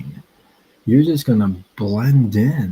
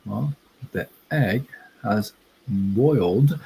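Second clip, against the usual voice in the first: usual speaking voice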